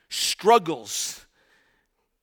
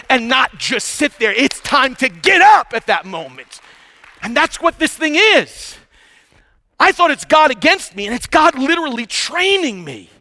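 building to high, loud sermon delivery; impassioned preaching; 7 semitones higher, 9 dB louder than usual. Real, not acted. Preacher urging the congregation to get up, impassioned preaching. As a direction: drive it home at full preaching force. Preaches at rising full force.

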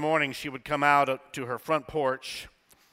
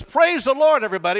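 higher and louder, a preacher's call out; loud call to praise; 13 semitones higher, 13 dB louder, faster than usual. Shown as a demonstration. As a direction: call out to the whole room. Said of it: "So when someone says".

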